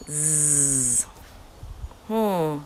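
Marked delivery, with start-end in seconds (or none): 0.1-1.0 "zzz" as a long steady buzz, voiced z held; buzzing z sound. Shown as a demonstration. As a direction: hold a buzzing "zzz" like a bee. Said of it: "words can you think of that starts with".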